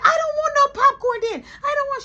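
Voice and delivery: put-on very high loud whining child; high squealing kid refusing popcorn; kid brother tantrum. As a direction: throw a little-boy fit.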